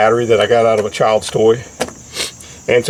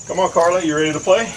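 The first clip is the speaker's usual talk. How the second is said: talking to a dog; 6 semitones higher than usual. Real, not acted. Letting dog out to play.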